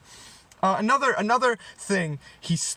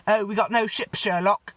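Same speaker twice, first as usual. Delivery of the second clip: James McAvoy; 4 semitones higher than usual. Acted. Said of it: "James McAvoy voices, um, um, Romeo".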